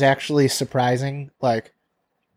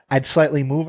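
normal talking voice

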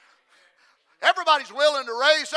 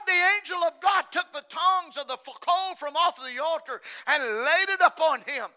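normal talking voice

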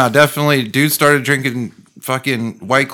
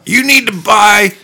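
conspiracy theorist; 7 semitones higher, 4 dB louder than usual. Retold or imitated. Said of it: "he started believing his own shit".

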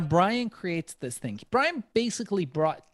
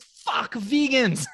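exasperated outburst; 8 semitones higher, much slower than usual. Retold or imitated. Dismay at party retold.

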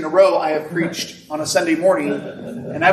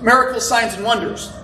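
rising, emphatic sermon voice, higher; preaching; 5 semitones higher than usual. Real, not acted. Pastor preaching to the congregation on Holy Spirit, emphatic. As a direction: preach it with building conviction. Preaching builds through the verse.

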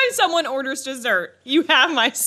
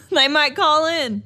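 slow, higher mock Southern drawl; 6 semitones higher, slower than usual. Second take, redneck.

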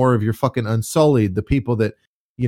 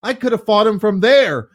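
indignant; 12 semitones higher than usual. Pretend. Voicing Dany's frustration.

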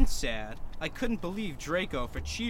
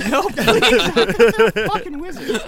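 laughing; 8 semitones higher, 14 dB louder than usual. Real, not acted. Laughing through reading.

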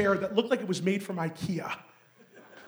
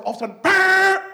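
loud mock alarm buzz; scanner buzz; 11 semitones higher, 10 dB louder than usual. Mimics the airport scanner alarm.

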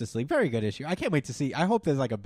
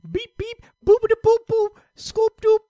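high, loud beep-boop chirps; beeping machine noises; 10 dB louder than usual. Retold or imitated. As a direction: chirp out gadget beeps. Batman's gadget noise.

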